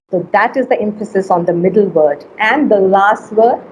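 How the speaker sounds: normal talking voice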